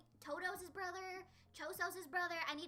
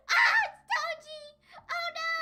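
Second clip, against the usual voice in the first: high, loud, hammed-up alarm; shocked shriek; 12 semitones higher, 16 dB louder, slower than usual. Reacting to the Toji flashback, loud high shriek. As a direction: cry out in high-pitched mock panic.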